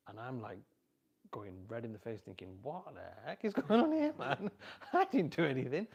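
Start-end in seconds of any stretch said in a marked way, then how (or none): 2.6-5.9 much higher and louder, laughing in embarrassment; flustered laughing exclaim. Retold or imitated. Caught out in front of everyone, laughing and flustered. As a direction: blurt it out red-faced and laughing.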